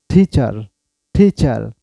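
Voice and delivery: টিচার with an aspirated ঠ-like t and final r; টিচার, hard ঠ at the start; টিচার pronounced carefully, teacher model; correct pronunciation. Shown as a demonstration. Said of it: "সঠিক উচ্চারণ".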